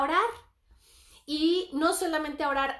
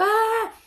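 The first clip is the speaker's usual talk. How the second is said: sudden high, loud mock cry of a waking child; imitated child's wail; 8 semitones higher, 8 dB louder than usual. Mother imitating her child waking from a nap crying. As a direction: let out a child's startled wail.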